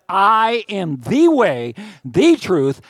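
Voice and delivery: very slow and loud, each phrase hammered; emphatic preaching. Acted. Preacher quoting Jesus to the congregation, slow and loud. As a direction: proclaim it slowly like a banner over the crowd. Pastor quotes Jesus at climax.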